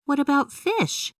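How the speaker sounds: put-on high, curious young girl; a kid puzzling it out; Emily's voice. A narrator's reading. Emily asks her dad about fish, high girlish voice. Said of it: "Emily asked".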